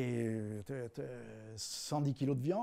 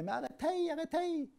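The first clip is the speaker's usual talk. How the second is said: rabbi pleading; 12 semitones higher, 3 dB quieter than usual. Acted. Rabbi pleads in back seat.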